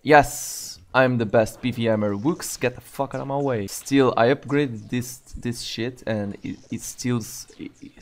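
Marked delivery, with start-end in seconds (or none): none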